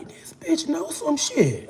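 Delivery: drawn-out high-pitched mock awe; imitated wary look. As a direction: voice her disbelief, high and drawn out. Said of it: "she looked at me like".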